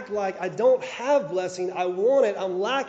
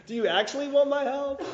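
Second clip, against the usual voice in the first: mock indignant; 7 semitones higher than usual. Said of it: "and go".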